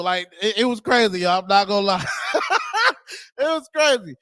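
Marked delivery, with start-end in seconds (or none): 2.1-3.3 high-pitched cackle of disbelief; high cackling laugh; high burst of laughter. Real, not acted. Laughing at the video.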